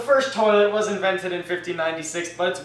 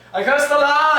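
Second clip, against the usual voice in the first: loud, higher curse; hammed-up curse; 8 semitones higher, 6 dB louder than usual. Mother joining the cursing, loud and high. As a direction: spit the curse at the land.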